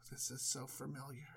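sigh, then low and very soft; deadpan aside under a heavy sigh; weary resignation. Acted. Wife faces the same old ruse, weary resignation.

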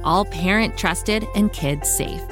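plain reading voice

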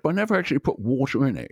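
put-on Scottish burr, hurrying along. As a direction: imitate the film star's slushy Scottish s. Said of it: "I met [name] and [name]".

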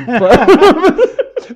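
loud laugh through the nose; a real, loud chuckle. Real, not acted. Laughs at own joke.

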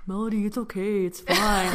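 a hint of the brother's easygoing reassurance; lightly voiced laid-back brother, soothing his sister; mild mock chill brother telling Melody it's okay; imitating calm brother. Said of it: "he talks just like that".

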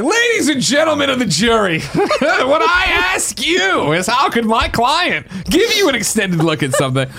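high, slow, laughing courtroom lawyer; honeyed southern lawyer drawl. Retold or imitated. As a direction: plead to the jury like a showman lawyer. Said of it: "I like [name] right now".

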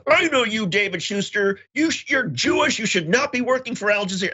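high-pitched, accusing stranger voice; hostile accusation. Retold or imitated. Stranger on the subway calls him out by name, high and accusing. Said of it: "He says".

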